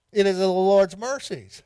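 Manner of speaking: preaching emphasis. Acted. Refrain raised in preaching.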